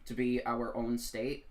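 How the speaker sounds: ordinary talk, nothing marked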